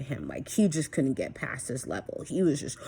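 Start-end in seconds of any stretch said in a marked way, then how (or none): none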